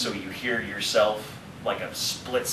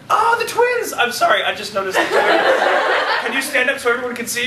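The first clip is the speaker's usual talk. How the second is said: sudden delighted outburst, much higher; surprised; 8 dB louder than usual. Real, not acted. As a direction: burst out at a happy discovery. Suddenly spots the twins.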